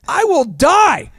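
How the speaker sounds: high, slow mock-dramatic vow; melodramatic refusal. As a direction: draw it out like a hammed-up last stand. Comic refusal to quit DJing.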